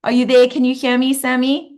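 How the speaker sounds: normal talking voice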